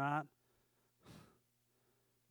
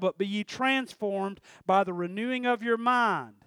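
louder preaching voice; 3 semitones higher, 6 dB louder than usual. Acted. Preacher reading the verse to the church, forceful. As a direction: drive the verse home.

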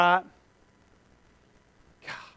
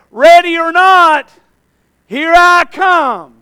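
put-on childhood game call; 3 semitones higher, 8 dB louder, slower than usual. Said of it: "like the old hide and go seek".

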